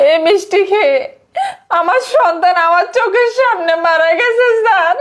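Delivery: wailing, sobbing shout; crying. Acted. Mother crying over dead son. (কান্নায় ভেঙে পড়ে চিৎকার)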